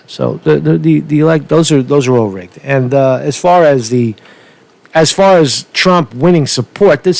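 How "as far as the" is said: ordinary talk, nothing marked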